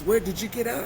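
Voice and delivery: high, loud excited question; imitated eager friend. Friend hears he is out of prison, excited. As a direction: put on a buddy's hyped phone voice.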